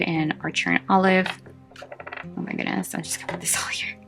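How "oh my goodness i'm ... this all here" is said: whispering. Real, not acted. Muttered aside while rearranging tapes.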